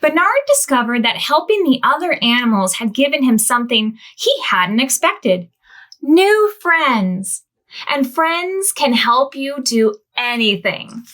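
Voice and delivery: gentle storybook reading to little ones; reading to children. A narrator's reading. Storyteller reading to children, gently. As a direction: read it like a bedtime picture book. Reads picture book aloud.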